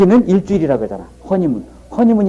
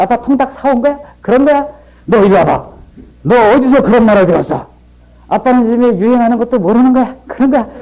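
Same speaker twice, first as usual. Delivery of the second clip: voicing son and angry father; 6 semitones higher than usual. Son teases, father scolds.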